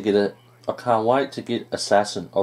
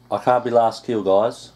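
normal talking voice

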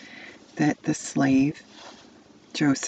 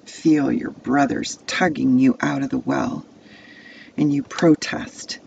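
usual speaking voice

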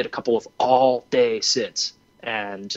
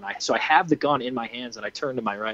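normal talking voice

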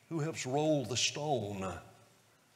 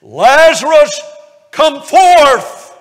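much louder and higher, a ringing call; 13 semitones higher, 23 dB louder, much slower than usual. Retold or imitated. Jesus calling Lazarus from the tomb with a loud voice. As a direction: call the dead out of the grave. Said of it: "said with a loud voice".

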